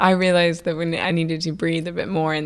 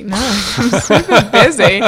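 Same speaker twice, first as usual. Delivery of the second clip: laughing; 10 dB louder than usual. Real, not acted. Laughing while brushing chef off.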